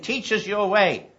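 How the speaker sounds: pleading crowd. Retold or imitated. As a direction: beg to be taught.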